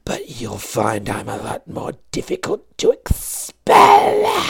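slow, hushed whisper rising to a shout; a creepy demon hissing from the dark; sinister whisper swelling into a cry; menacing villain voice. A narrator's reading. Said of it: "we hear a voice from the background".

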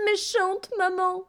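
put-on very high, slow wailing child; high slow child's wail; upset little girl. Girl repeats, higher.

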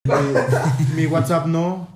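a hint of a slow, solemn heartfelt plea; mock serious plea. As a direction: play it dead serious, slowly. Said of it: "quiero silencio, por favor". (súplica fingida lenta y solemne)

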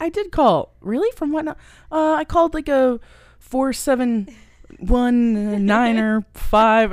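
stammering, caught out; flustered Tommy Boy impression. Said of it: "how he's just like".

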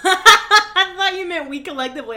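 laughing through the words. Real, not acted. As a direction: laugh while you talk it out. Laughs at own misunderstanding.

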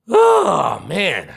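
high, sharp cry of exasperation; a disgusted high yelp; exasperated oh. Real, not acted. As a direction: throw the oh way up then drop. Exasperated exclamation after rant.

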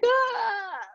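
high crying cry out; crying gasp of relief. Retold or imitated. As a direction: let out a sudden sobbing cry. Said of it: "And I was like".

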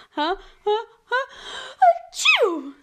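big fake sneeze; pretend sneeze. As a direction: wind up and sneeze loudly.

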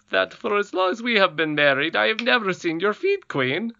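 a hint of a henpecked husband; lightly voiced goblin king. A narrator's reading. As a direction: goblin king asking about her feet.

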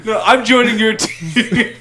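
high, loud, laughing insistence; excited. Acted. Itachi insists on joining Pain's team, excited. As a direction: insist gleefully, stretched through laughter.

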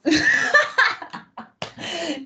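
high, long bursting laugh; real amused laughter; delighted laughter. Real, not acted. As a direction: laugh hard at the scene. After friend's panic.

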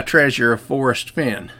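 usual speaking voice

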